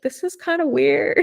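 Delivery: high-pitched uneasy reaction; voiced-up retold unease; uneasy protest. Voicing her uneasy reaction at midnight.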